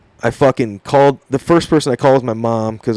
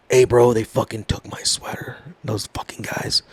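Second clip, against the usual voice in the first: hushed complaint; 3 semitones lower, 7 dB quieter than usual. Retold or imitated. Buddy's hushed complaint at bar.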